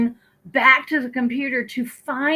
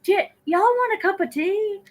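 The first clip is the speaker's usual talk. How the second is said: higher, bright hostess offering tea and coffee; hostess offering drinks; 8 semitones higher than usual. Retold or imitated. Host to online guests, mock hostess offering tea. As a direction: playfully play the fussing host pouring drinks. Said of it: "I feel like".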